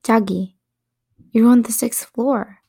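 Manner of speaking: plain reading voice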